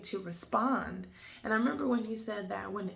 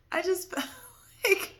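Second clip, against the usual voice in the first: laughing through tears; 10 semitones higher, 7 dB louder than usual. Real, not acted.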